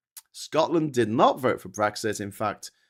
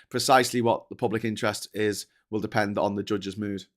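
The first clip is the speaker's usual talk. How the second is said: ordinary talk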